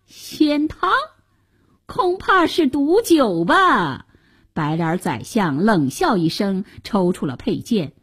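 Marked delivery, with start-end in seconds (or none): 0.1-4.1 high, drawn-out sceptical challenge; suspicious sneer. A narrator's reading. Minister doubting the soldier's immortality potion, suspicious. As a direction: question it with pointed disbelief.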